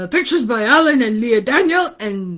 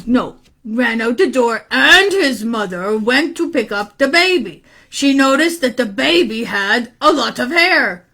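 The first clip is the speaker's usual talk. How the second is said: ordinary delivery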